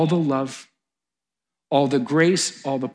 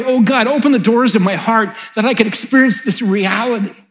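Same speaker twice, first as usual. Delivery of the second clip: high, loud plea to God; fervent prayer; 8 semitones higher, 9 dB louder than usual. Shown as a demonstration. Preacher modelling a prayer for the church, fervent plea. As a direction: cry out the prayer with full force.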